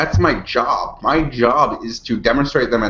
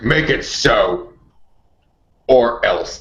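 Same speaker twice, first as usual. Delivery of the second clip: implied threat; much slower than usual. Retold or imitated. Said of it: "an "or else" there, but not being said".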